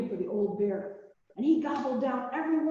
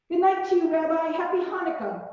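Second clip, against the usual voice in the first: higher and louder, calling a goodbye after the bear; old woman calling goodbye at the door; 7 semitones higher, 3 dB louder than usual. A narrator's reading. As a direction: call it across the room. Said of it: "Baba Brena called".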